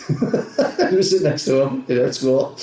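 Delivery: words slowed by real laughter; amused telling through laughter; laughing while talking. Real, not acted. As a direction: let the laugh spill into the line. Class laughing at golfer's answer.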